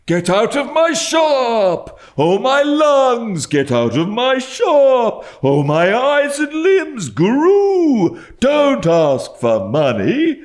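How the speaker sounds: high, loud, slow sing-song ranting; furious howling. A narrator's reading. Mad old dealer ordering the boy out of his shop, howling. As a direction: drive the boy out with a wild wail. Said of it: "delivered in a sort of tune".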